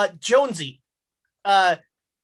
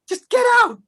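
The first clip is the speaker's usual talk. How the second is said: much higher, loud furious get out; mother screaming; 8 dB louder than usual. Acted. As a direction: shriek the order. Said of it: "she just keeps saying".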